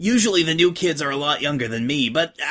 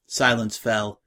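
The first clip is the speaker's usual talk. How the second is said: plain reading voice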